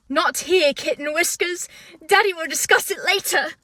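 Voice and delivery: attempted put-on character accent; Prince Charming. Host attempting the iconic line, mock prince accent. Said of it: "should I try to do the accent?".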